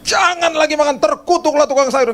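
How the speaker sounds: husband pretending to blow up at the maid; mock furious rant. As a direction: rant like an outraged boss, over the top. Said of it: "saya nggak pernah ngomong gitu".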